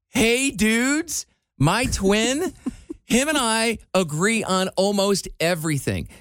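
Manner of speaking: dismayed twin, half joking; mock dismay. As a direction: act betrayed by your twin. Mock dismay at twin's shoes.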